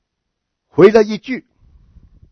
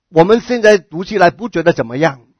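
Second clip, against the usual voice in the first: normal talking voice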